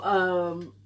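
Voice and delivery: normal talking voice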